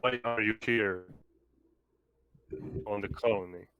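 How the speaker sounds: normal talking voice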